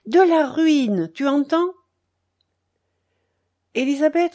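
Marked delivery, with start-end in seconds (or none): 0.1-1.7 high, loud, drawn-out emphasis; slow, raised, demanding; forceful insistence. A narrator's reading. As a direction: hammer it home to a stubborn listener. Husband hammers home his ruin.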